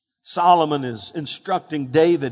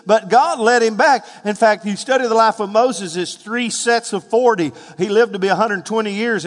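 normal talking voice

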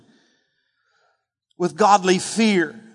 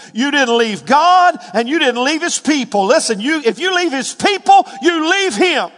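higher and louder, driving the point home; fervent preaching; 7 semitones higher, 4 dB louder than usual. Real, not acted. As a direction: preach it hard to the congregation. Preaching at full force.

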